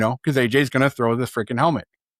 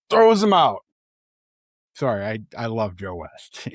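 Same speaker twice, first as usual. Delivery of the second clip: usual speaking voice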